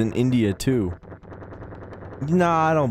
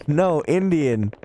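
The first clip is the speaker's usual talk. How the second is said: ordinary talk, nothing marked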